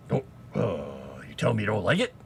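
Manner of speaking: lightly put-on character voice; Beetlejuice. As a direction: tease in a mock ghoul voice. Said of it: "I started doing that more".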